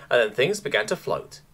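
ordinary delivery

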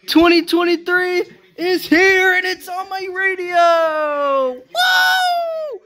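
high shouted cheer; excited shout. Real, not acted. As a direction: yell it out in celebration. Celebrating new year on radio.

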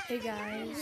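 soft put-on girly voice; lightly voiced girl; man doing a woman's voice. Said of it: "I gotta get my girl voice ready".

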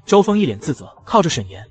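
ordinary delivery, nothing marked

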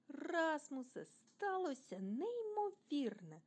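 high, drawn-out excited cry; joyful exclamation. A narrator's reading. Timmy tells Rasmus the incredible news, joyfully exclaiming. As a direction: stretch the amazing news out with delight. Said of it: "радісно вигукнув Тімі".

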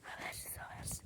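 faint whisper; hushed secret whisper. Real, not acted. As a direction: barely breathe "habersiz".

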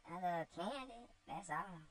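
put-on cheeky girl shrugging off blame; voiced slave girl Topsy. Topsy answers her master with cheeky girlish voice.

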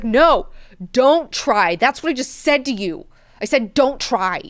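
loud, high mock tirade of an irritated god-emperor; angry ruler. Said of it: "he's so angry".

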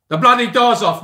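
high, loud bellowed catchphrase; shouted Michael Caine line. Retold or imitated. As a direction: belt out the famous line. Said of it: "give us some Sir Michael".

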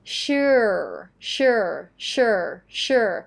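slow "sure" gliding into a final American R. Shown as a demonstration. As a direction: drill "sure" drawn out, each one falling. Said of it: "สำหรับ American จะมีอยู่อันนึง".

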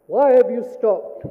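loud, slow indignant roar; big storybook lion roar. Acted. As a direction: bellow the question like an angry lion. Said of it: "roared the lion".

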